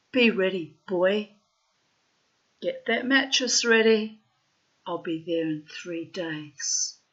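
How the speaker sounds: loud, firm acted order; punched-out warning in separate bursts; commanding. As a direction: give the boy his orders with force. Mother orders the boyfriend, acted.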